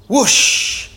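loud, airy whoosh noise; rushing whoosh. A narrator's reading. Toy car races down the mountain, rushing whoosh. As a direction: let a big breathy rush imitate speed.

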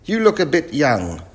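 loud, high professor voice; eccentric professor. Acted. Professor sizes up the young crew, loud and high.

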